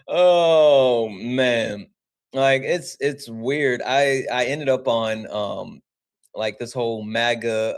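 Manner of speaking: usual speaking voice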